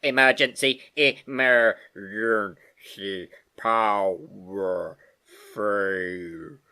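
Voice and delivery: a machine losing power; slowing record. Acted. Computer failing as power dies, winding down. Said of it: "very slow and very low".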